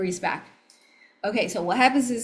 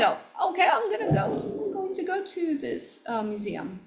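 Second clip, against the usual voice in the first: eager decision; 11 semitones higher than usual. Retold or imitated. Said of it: "I decide".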